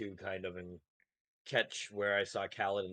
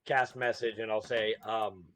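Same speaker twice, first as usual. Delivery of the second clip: ordinary talk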